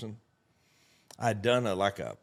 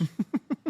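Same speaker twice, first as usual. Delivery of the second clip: delighted laugh; 13 semitones higher than usual. Retold or imitated. Kid laughs at pyramid pitch.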